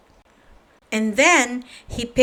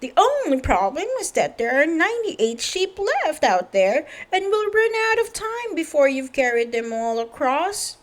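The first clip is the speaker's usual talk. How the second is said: higher, worried farmer voice; fretting farmer; 6 semitones higher than usual. Acted. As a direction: lay out the problem anxiously. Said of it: "said Farmer Fields".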